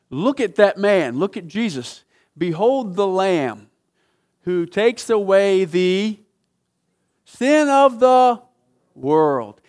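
preacher building up to the climax of Behold the Lamb; preaching proclamation. Acted. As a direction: stretch it out like a great announcement. Preacher voices John the Baptist.

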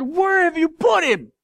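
loud, high-pitched shout; furious through tears. Retold or imitated. Weeping Jesus demanding where Lazarus is, furious shout. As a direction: cry out in acted anguished fury. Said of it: "And through his tears".

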